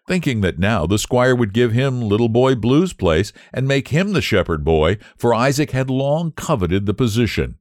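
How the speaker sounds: ordinary delivery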